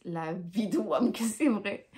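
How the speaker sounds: slow words breaking into a real laugh. Real, not acted. Telling viewers the embarrassing story, laughing. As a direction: laugh it out as you swear it's true. Laughing at her own misfortune.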